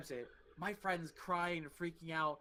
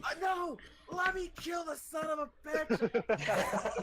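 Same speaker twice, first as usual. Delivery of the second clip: high, louder, drawn-out mock shout; retold furious yell, slow and pitched up; frenzied protest; 9 semitones higher, 5 dB louder, slower than usual. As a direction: act out straining to get back into the fight. Acts out frenzied refusal to stop.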